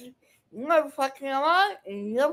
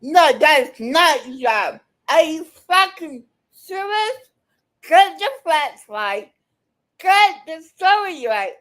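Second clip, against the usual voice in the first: angry shouting; 2 semitones higher, 5 dB louder than usual. Real, not acted. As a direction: shout him down, no room to answer. Shouting him down mid-argument.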